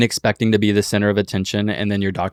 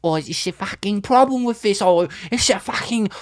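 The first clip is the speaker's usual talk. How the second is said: high mock Cockney voice; British accent; 11 semitones higher than usual. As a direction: ham up a London accent. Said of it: "you sound like a Victorian".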